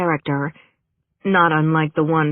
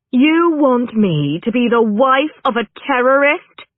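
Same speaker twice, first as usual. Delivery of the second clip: higher, louder indignant question; outraged disbelief; 8 semitones higher, 5 dB louder than usual. A narrator's reading. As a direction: throw it back at the agent, appalled. Outraged reply to insulting role offer.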